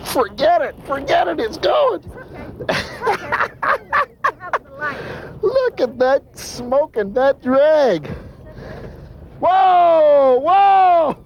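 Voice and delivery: high amazed shouting; excited shout. Real, not acted. Fish taking line from reel.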